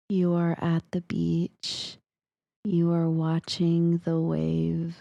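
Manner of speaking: imitating a brother's slow hypnotic suggestion; hypnotic persuasion. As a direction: coax a sleeper's dream, word by word. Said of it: "trying to hone his powers of persuasion".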